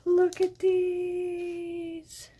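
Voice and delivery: high, long drawn-out wonder; awed delight. Real, not acted. Finding unicorns for her planner, awed delight. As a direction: stretch it out high after a gasp.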